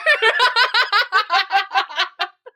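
long high cackle; loud laughter. Real, not acted. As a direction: burst out laughing. Laughing at own story. (放声大笑)

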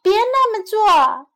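high, loud plea in Chinese; 大声哀求; pleading. Acted. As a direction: beg him to stop. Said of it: "老妇人喊道".

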